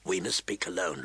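low, disguised stranger's voice. A narrator's reading. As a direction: drop it low and keep it strange. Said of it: "said in a strange, low voice".

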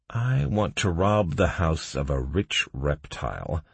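plain reading voice

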